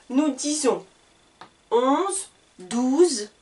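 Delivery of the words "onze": usual speaking voice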